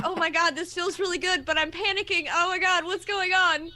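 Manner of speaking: high-pitched mock panic mixed with delight; retold happy freak-out; imitating panicked friend. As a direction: act out a flustered friend, pitched right up. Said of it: "panicking, but she was like".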